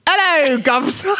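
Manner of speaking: hammed-up British "'ello, guv'nor"; British accent. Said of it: "read it with a British accent".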